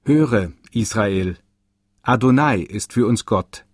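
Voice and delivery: usual speaking voice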